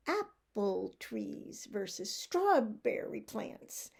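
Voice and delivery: APPLE and STRAWBERRY punched on the first syllable; slow beat on APPLE trees, STRAWBERRY plants; syllable stress. Shown as a demonstration. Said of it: "The stress on the syllable determines the rhythm".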